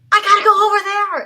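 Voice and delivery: mock yell, high and loud; over-the-top shout. Voice actor mimicking clumsy dub dialogue, over-the-top shout.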